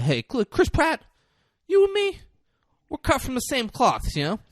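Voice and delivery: lightly voiced pushy boss; hammed-up buddy pitch. Boss buttering up Pratt.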